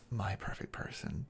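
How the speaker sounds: possessive whisper. Acted. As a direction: hush it close and possessive. Possessive lover claiming partner.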